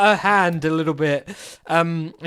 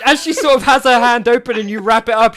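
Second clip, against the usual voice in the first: ordinary delivery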